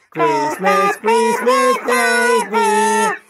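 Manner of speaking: squeaky chipmunk, amused; chipmunk voice effect. Shown as a demonstration. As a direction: play with a chipmunk voice changer. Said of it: "I sound like the chipmunk".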